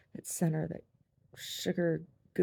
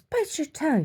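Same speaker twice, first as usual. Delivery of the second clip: very high, louder mock scolding; scolding grandma; 14 semitones higher, 5 dB louder than usual. Grandma telling mom off, high mock scolding. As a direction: chide them in a high grandma voice.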